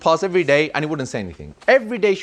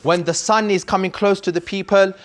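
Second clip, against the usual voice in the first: impassioned preaching; 6 semitones higher than usual. Acted. Preaching with rising force.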